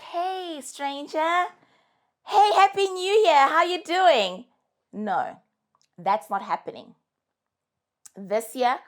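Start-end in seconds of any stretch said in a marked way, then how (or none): 0.0-1.5 higher, sing-song fake-friendly hello; mock sweet greeting. Mocking the ghost who reappears. 2.3-4.5 much higher and louder, gushing fake cheer; mock cheery greeting. Mocking the ghost who reappears.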